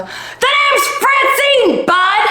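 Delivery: high, slow shouted name, swear swapped out; same yell, kid-friendly word; cleaned-up shout. Acted. Correcting herself for the kids, still shouting. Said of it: "Oh, wait, I'm around kids".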